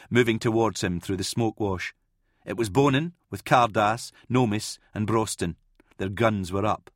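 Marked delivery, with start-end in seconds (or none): none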